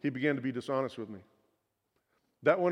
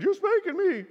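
imitating a small child, much higher and louder; crying little boy; 5 dB louder than usual. Father imitating his crying young son, high and loud. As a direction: squeal it like a wailing kid. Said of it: "he started crying".

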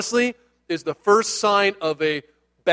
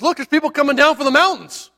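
high-pitched startled lookout; excited, pitched-up warning; alarmed calling out; 8 semitones higher than usual. Acted. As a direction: blurt out the sighting in mock alarm.

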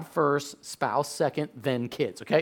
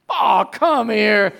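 loud, higher, drawn-out exasperation; exasperated giving-in; 4 semitones higher, 6 dB louder, much slower than usual. Retold or imitated. Dad giving in to his hungry son, exasperated call. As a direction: groan it out loudly and slowly.

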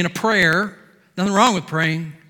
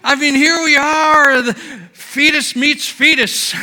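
high, loud, exultant preacher voice; full-force revival preaching; fiery preaching; 8 semitones higher, 8 dB louder than usual. Acted. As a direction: preach it with mounting joy. Excited preaching of the meeting.

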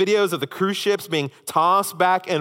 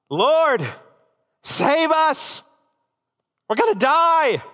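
high, loud desperate cry for help; panicked plea; 10 semitones higher, 4 dB louder, slower than usual. Acted. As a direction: beg the sleeping master to save you. Disciples waking Jesus in panic.

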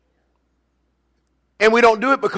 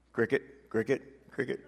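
whispered cricket joke; 7 semitones lower, 16 dB quieter than usual. Pretend. Mocking the silent congregation.

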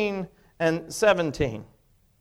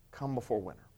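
softer echo; 9 semitones lower, 12 dB quieter than usual. Real, not acted. Repeating Paul's plea.

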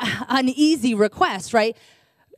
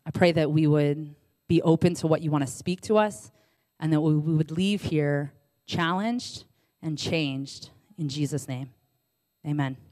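low, soft, unhurried public prayer; 6 semitones lower, 5 dB quieter than usual. Real, not acted. Preacher praying to God before the reading, low soft prayer. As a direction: pray softly, pausing between phrases.

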